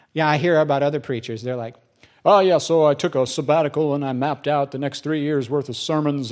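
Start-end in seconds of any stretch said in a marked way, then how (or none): 2.3-6.3 put-on voice of an over-prepared colleague; mimicked preacher. Other preachers describe planning sermons years ahead, mock self-satisfied. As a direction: mockingly play the well-organized preacher.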